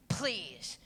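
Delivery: breathy dismissive please; eye-roll please; scoffing whisper. Real, not acted. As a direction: brush the excuse off under your breath. Scoffing at the magic ring excuse.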